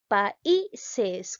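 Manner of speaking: "países" in separate syllables, I standing out; stress on the I. Shown as a demonstration. Teacher asking A or I, stressing I in "países". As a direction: break up "países" and push the middle I. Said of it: "Ahora pronunciamos".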